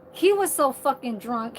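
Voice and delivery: normal talking voice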